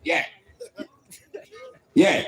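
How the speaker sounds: normal talking voice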